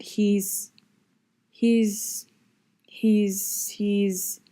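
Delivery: halting repeated "he's", stuck on the word; hesitant stammer; stuttering. A narrator's reading. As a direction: stumble over "he's" as if lost for words. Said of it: "Mister P stuttered".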